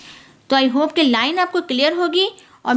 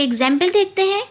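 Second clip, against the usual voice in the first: ordinary talk, nothing marked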